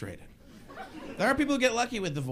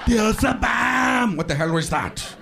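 put-on slow loud accented shout; Schwarzenegger yell; 4 semitones higher, 5 dB louder, slower than usual. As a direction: bark it like an action hero. Schwarzenegger yelling bit.

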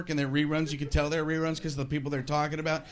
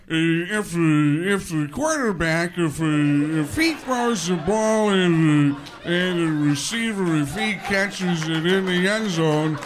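louder, slow, stammering commentator voice; John Madden; 4 dB louder, slower than usual. Retold or imitated. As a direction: ramble and stutter like a clueless commentator. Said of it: "Madden will say things like".

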